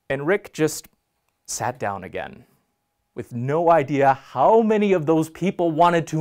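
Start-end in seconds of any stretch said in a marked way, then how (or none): none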